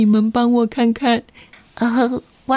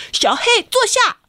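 sharp, high command to a dog; lady scolding dog; 11 semitones higher than usual. Acted. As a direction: snap the dog to sit. Neighbor lady commands her dog.

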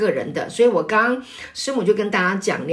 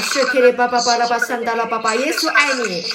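speaking in tongues; 3 semitones higher than usual. Real, not acted. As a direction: pour out tongues fervently, in a steady chant. Praying in tongues aloud.

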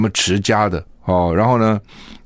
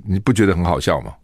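ordinary talk, nothing marked

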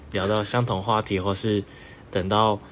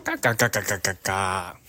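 goose honking. Pretend. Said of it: "我是鹅".

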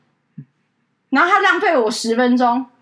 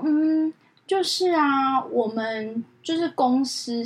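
slow, halting caller; hesitant caller mimic; 5 dB quieter, much slower than usual. Assistant imitates dithering caller, slow and halting. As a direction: drag the words, unsure.

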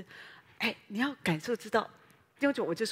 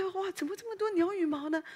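higher, voice cracking with alarm; shocked surprise; 9 semitones higher than usual. Retold or imitated. Preacher retelling finding feathers, alarmed surprise. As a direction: gasp at all the feathers.

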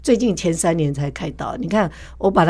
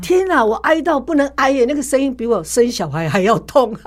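higher, animated exclamation; excited retelling; 6 semitones higher than usual. Real, not acted. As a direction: relive the pain with a laugh in the voice. Recalls crying out in pain. (激动地回忆，声音上扬)

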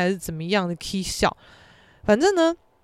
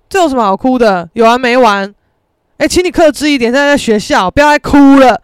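loud, exasperated telling-off; loud, fed-up scolding over her crying; 4 semitones higher, 12 dB louder than usual. Retold or imitated. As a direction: snap at her to stop crying. Friend loses patience with crier.

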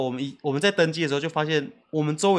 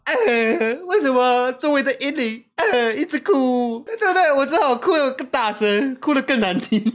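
mock whiny wail; 8 semitones higher, 4 dB louder, slower than usual. 假装大声哭的人.

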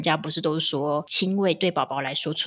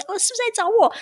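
much higher, half-asleep asking; high groggy question; 3 dB louder, faster than usual. Retold or imitated. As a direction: ask sleepily, voice way up.

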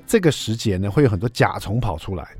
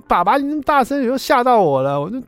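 put-on much higher little-boy complaint; imitating a child; 12 semitones higher than usual. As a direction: whine it like a rattled kid at the piano. Son complains the dad is too loud.

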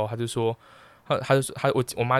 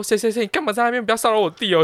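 playful scolding; 11 semitones higher, 5 dB louder than usual. Retold or imitated. Sister scolding on the phone.

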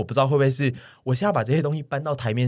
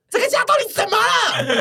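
这个家到底怎么了 cried out very high, laughing through it, 到 peaking then falling; half-laughing replay of a desperate yell, pitched way up; mock yell; 4 dB louder than usual. Son yelling at his family in the living room, laughing mock yell. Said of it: "我就在客厅大喊".

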